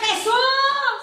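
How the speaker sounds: high, urgent pleading call; acted high, stretched plea; forceful crying out. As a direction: cry out for mercy over the crowd. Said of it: "gritó con más fuerza".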